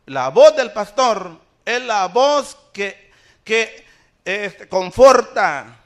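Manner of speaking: loud, insistent pulpit warning; forceful preaching. Real, not acted. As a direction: hammer the repeated phrases home. Preacher warns about other voices.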